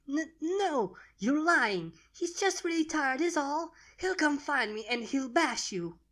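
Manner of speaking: put-on high child voice, close to tears; fighting tears in denial; voice cracking. Baby dragon tells Steamlord he is lying, voice cracking. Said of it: "her voice started to crack".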